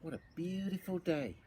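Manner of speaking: ordinary delivery, nothing marked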